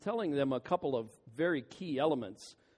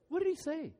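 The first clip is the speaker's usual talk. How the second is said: high, puzzled mock churchgoer voice; confused listener; 13 semitones higher, faster than usual. Churchgoer scratching his head after the sermon, high and baffled. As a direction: squeak it out like a baffled parishioner.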